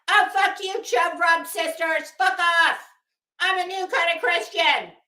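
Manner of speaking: mock host cursing out the chat; indignant, hammed-up parody; P G imitation. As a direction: curse them off, then declare it proudly. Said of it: "that's a P G imitation".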